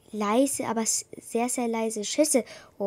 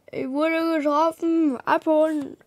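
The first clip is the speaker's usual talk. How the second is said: higher, louder, pained call; wounded cry; 6 semitones higher, 7 dB louder than usual. Acted. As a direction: cry out in pain. Calling for rescue. (getroffen aufschreiend)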